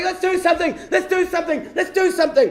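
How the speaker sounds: put-on high, insistent drunk chant; hammed-up, high-pitched badgering; wild drunken urging. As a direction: pester like a drunk who won't let go. Drunk sailor rolling around, pestering him.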